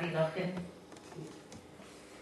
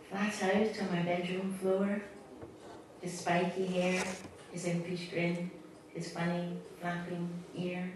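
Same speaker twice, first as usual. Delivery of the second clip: much softer, slow, grieving close; sad fading; 7 dB quieter than usual. Acted. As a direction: let the poem fade into sorrow. Imaginary friend's death, poem ending.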